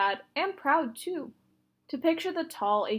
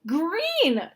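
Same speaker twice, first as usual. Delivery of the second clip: put-on child's high, loud wail of dismay; little girl wailing at ugly shoes; 7 semitones higher, 7 dB louder than usual. A narrator's reading. Wailing at her sister over green shoes. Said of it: "Kirsty wailed".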